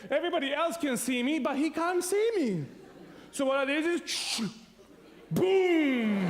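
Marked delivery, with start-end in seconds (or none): 5.3-6.3 gunshot boom. Retold or imitated. Firing the toy shotgun.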